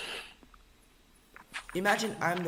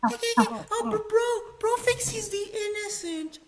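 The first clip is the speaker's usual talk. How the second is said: mocking voice; 5 dB louder than usual. Mocking the friend's reaction.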